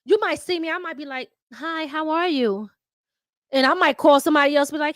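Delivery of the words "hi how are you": slow, polite "Hi, how are you?"; prim, slow greeting; proper polite voice. Shown as a demonstration. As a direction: sound proper and refined. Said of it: "I know how to switch it up".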